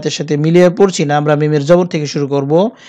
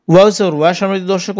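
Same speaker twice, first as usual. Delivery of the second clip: ordinary delivery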